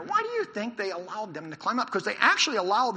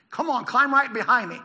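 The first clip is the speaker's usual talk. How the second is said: higher, louder rallying call; calling to follow; 6 semitones higher, 8 dB louder than usual. Acted. Jonathan to his armor bearer, raised urging call. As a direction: urge your companion up behind you, loudly. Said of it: "Jonathan said to his armor bearer".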